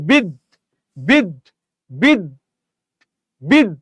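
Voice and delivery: বিদ stopped on the d; closed syllable. Shown as a demonstration. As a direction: isolate বিদ and block it at the final d. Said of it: "আটকে যাচ্ছে".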